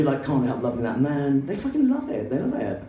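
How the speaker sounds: ordinary talk, nothing marked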